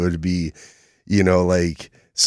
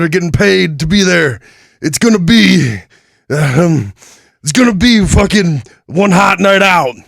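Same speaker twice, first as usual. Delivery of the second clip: loud, high wrestler shouting trash talk; wrestling promo; 9 semitones higher, 5 dB louder than usual. Acted. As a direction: bellow it like a pro wrestler. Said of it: "Oh, let's cut a promo".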